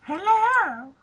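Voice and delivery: high, breathy-sounding sultry hello; sexy voice. Pretend. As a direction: purr it like a seductive voice. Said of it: "That was my lovely, sexy voice".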